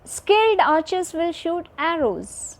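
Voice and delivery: high-pitched opening on "skilled", dropping to low at "arrows"; statement tune, high to low. Shown as a demonstration. Teacher models a sentence for children, high-to-low tune from "skilled" to "arrows". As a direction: start high on "skilled" and fall away on "arrows". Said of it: "our voice goes from high to low".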